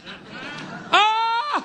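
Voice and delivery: sharp, high, loud laugh. Real, not acted. Preacher laughing at his own story, high laugh. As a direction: bursts out laughing at the punchline.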